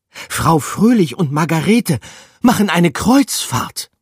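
high, loud breathless rat voice; excited rat. A narrator's reading. Rat telling the mice about the cruise, high and excited. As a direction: blurt the big news.

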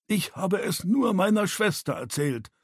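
mildly aggrieved self-defence; protesting. A narrator's reading. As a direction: protest your innocence. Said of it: "protestierte Bucky".

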